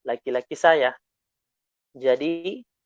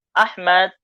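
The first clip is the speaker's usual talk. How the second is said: ordinary delivery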